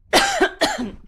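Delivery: loud hacking cough; real cough. Real, not acted. Host interrupted while reading viewer names, real cough. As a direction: cough hard, clearing the throat. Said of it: "Typical, everybody. I'm so sorry".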